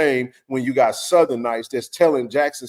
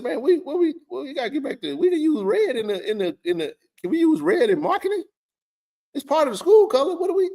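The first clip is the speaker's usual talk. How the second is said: much higher retold plea, half-pleading; exasperated retelling; 14 semitones higher than usual. Telling A D he wants red back, high and exasperated. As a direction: voice jumps way up as he replays pushing the point. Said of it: "I told him, I said".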